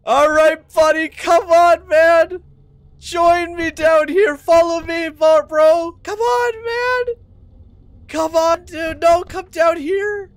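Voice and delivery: frantic gamer coaxing; excited urging shout. Real, not acted. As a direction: yell at him to follow you down.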